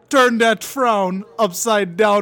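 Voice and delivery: high, loud, slow put-on Jamaican lilt; mock Jamaican. Friend quoting the ad in a put-on Jamaican accent. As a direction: sing out the ad line in a Jamaican accent.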